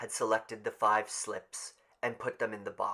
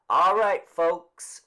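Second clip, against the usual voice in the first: loud official announcement; calling out; 8 semitones higher, 13 dB louder, slower than usual. Acted. Mister Summers to the crowd, loud and official. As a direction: call it across the square.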